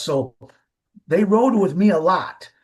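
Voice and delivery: normal talking voice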